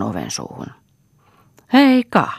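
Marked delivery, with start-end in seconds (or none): 1.7-2.3 high, loud call of greeting; hailing greeting. A narrator's reading. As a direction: call out a sudden hello to the household. Tulija huutaa tervehdyksen talon väelle. (kova, korkea tervehdyshuuto)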